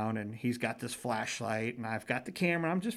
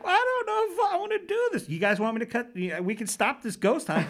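put-on tearful girl; 10 semitones higher, 3 dB louder than usual. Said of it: "she starts to cry".

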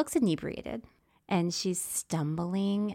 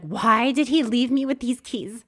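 slow, louder exasperated complaint; 4 semitones higher, 7 dB louder, slower than usual. Retold or imitated. Cursing Sean over the keys, exasperated. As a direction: blame him, drawn out and loud.

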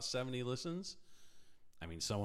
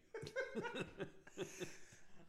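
laughing; 11 dB quieter than usual. Real, not acted. Laughing at mimic.

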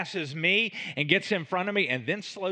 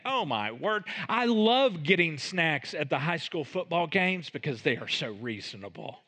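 usual speaking voice

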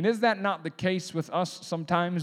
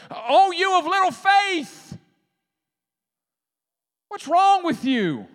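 much higher, loud, slow exasperated rebuke; frustrated Jesus; 12 semitones higher, 8 dB louder, slower than usual. Retold or imitated. Grouchy Jesus rebuking his scared disciples, exasperated. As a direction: scold them like a man just woken from a nap. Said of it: "you can hear some of the frustration".

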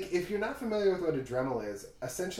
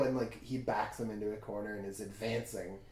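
normal talking voice

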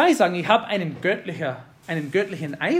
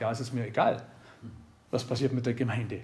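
low, quiet, couldn't-care-less delivery; mildly acted indifference; 7 semitones lower, 7 dB quieter, faster than usual. Preacher mimics an uncaring spiritual leader, indifferent. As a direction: shrug it off softly, low in pitch.

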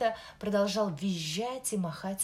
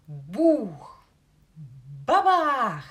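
crash sound effect; 2 semitones higher, 11 dB louder, much slower than usual. Acted.

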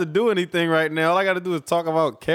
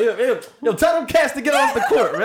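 put-on young old head; 8 semitones higher than usual. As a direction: bark at the kids like a grumpy old head. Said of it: "He be like".

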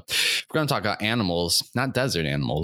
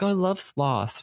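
mock gushing fan; 11 semitones higher than usual. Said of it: "And people are like".

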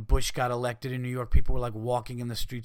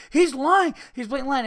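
much higher, loud indignant accusation; mock outrage; 13 semitones higher, 7 dB louder than usual. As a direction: overplay the hosts' indignation.

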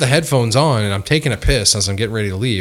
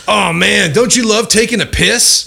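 high, drawn-out mock enthusiasm; imitating gushing stranger; 11 semitones higher, 4 dB louder, slower than usual. Retelling a stranger's chat at the urinal, high gushing imitation. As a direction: gush like an overeager stranger.

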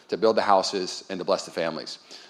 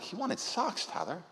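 hushed, moved retelling; 8 semitones higher, 13 dB quieter, slower than usual. Retold or imitated. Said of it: "She's like".

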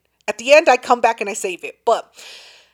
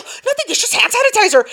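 high-pitched mock-innocent brush-off; acted-out flustered denial; defensive denial; 12 semitones higher, 3 dB louder than usual. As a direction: insist it's nothing, voice pitched up. Acted defensive reply to friend's alarm.

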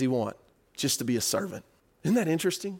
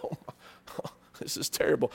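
soft laughing moan; laughing groan; 6 semitones lower, 9 dB quieter than usual. Retold or imitated. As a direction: groan through a laugh about overeating. Overeater groaning after meal.

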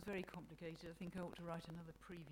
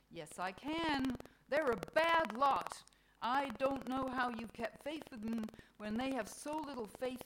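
loud, raised, emphatic tirade; exasperated Mother Nature; 6 semitones higher, 10 dB louder than usual. Acted. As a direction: berate God as a fed-up Mother Nature. Voices Mother Nature's angry tirade.

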